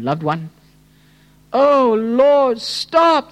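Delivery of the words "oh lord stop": high, drawn-out cry to God. Acted. Preacher praying as for a wayward loved one, crying out. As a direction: wail the plea slowly, voice raised. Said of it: "the heart crying out to God".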